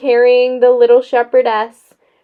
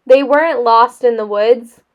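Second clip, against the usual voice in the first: plain reading voice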